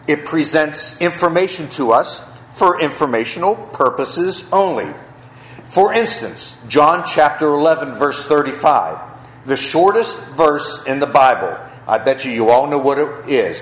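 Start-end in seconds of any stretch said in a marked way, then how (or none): none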